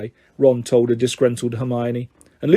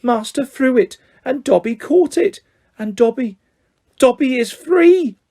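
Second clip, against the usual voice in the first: overjoyed elf; 9 semitones higher than usual. A narrator's reading. Dobby realising before Malfoy that he is free, overjoyed. Elf rejoices at being freed.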